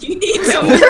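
said through laughter, high and loud; laughing. Real, not acted. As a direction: crack up mid-sentence. Repeats the tease laughing.

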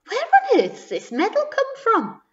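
high, swooping bewildered question; puzzled surprise. Acted. Owner finding a mystery medal at home, astonished.

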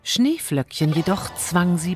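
ordinary delivery

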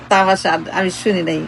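ordinary delivery

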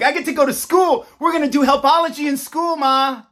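put-on child bursting to get to school; excited kid. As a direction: play the thrilled little kid calling to Ma. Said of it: "Don't you wanna be like".

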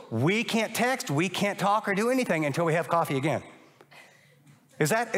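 ordinary talk

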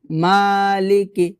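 মালিকি with মা held, লি and কি short; recited মালিকি, short last two syllables; long MA only. Shown as a demonstration. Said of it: "এগুলোতে টান হবে না".

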